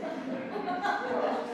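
laughing through the words; a real laugh breaking in. Real, not acted. Author laughing as she recalls writing.

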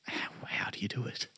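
whispered, pretend awe; playful, barely voiced disbelief; mock hushed wonder. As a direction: whisper it to absent idols in mock amazement. Mock question to Rolling Stones.

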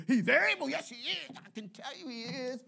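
imitating a churchgoer, high and dwindling; mock churchgoer praise. Mimicking churchgoer's praise, fading out.

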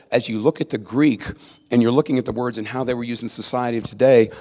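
ordinary talk

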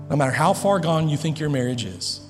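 normal talking voice

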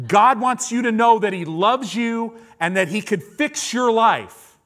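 high, loud re-enacted proclamation; yelling. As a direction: shout the message through the car window. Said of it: "so I'm yelling, I'm like".

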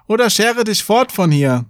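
high-pitched mild called threat; calling out. A narrator's reading. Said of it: "rief der Junge". (drohend gerufen, mit erhöhter Stimme)

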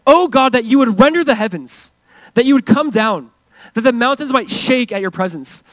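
lightly acted prophet's plea; Isaiah. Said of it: "he put it this way".

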